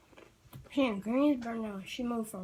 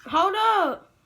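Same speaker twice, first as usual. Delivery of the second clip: sudden loud hold up; surprised; 8 semitones higher, 9 dB louder than usual. Real, not acted. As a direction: stop short, higher and louder. Sudden exclamation.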